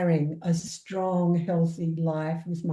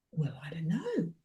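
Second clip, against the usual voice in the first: soft whisper of doubt; whispered unsure; 3 semitones lower, 8 dB quieter than usual. Retold or imitated. Client Glad unsure how this is different, whispered. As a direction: breathe it out, unsure.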